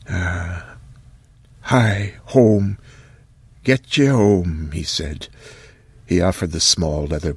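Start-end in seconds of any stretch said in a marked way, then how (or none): 0.1-4.7 lightly voiced old man sending her home; slow olden urging; old man Occam.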